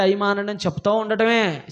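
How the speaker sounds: usual speaking voice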